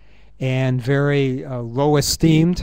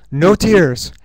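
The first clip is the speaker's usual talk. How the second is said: louder, higher firm order; firm command; 4 semitones higher, 9 dB louder than usual. Retold or imitated. Dying father telling his daughter, firmly and loudly. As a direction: tell her sternly not to cry. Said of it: "And he says to her".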